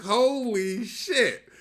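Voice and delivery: slow, drawn-out amazed exclamation; real astonishment, stretched out; stunned amazement. Real, not acted. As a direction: let the surprise land. Stunned realisation.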